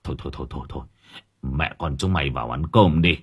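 low, very soft repeated stutter; hushed, rattled old man's stammer; stammering, shaken. A narrator's reading. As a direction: stammer it out under the breath. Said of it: "miệng của ông lắp bắp".

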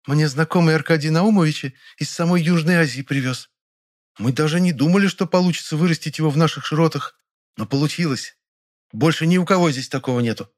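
high, pleased bragging; proud boasting. Acted. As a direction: blush and boast. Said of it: "зарделась и похвасталась".

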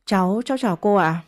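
usual speaking voice

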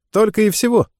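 high, mocking question; cheeky retort. Caught cadet answers the captain with cheek, high and mocking. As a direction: scoff at the punishment.

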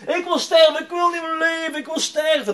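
very high, loud desperate cry; imitated hysterical wailing. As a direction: wail it out like someone hysterical. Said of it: "hij was echt hysterisch aan het huilen".

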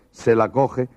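ordinary delivery

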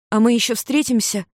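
hopeful question. A narrator's reading. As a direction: ask it with hope, pitch lifted. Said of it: "с надеждой спросила Рури". (с надеждой, повыше)

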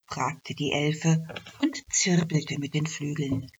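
ordinary delivery, nothing marked

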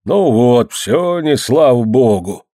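grumble, stretching out the complaint; grumbling. A narrator's reading. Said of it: "заворчал Никич". (ворчливо, нараспев)

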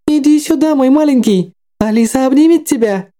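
very high, sing-song creepy doll; jerky animatronic voice. Acted. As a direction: play it like a haunted toy. Doll Alice reaches to hug.

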